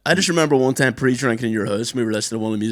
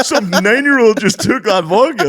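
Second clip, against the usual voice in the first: laughing; 10 semitones higher, 7 dB louder, slower than usual. Real, not acted. Laughing while telling the story.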